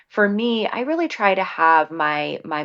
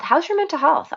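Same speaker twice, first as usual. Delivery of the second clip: put-on bright, much higher cheery question to the kids; cheery parent check-in; 11 semitones higher, 3 dB louder than usual. Shown as a demonstration. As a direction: toss it out lightly like a friendly parent. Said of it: "teach them about mental health".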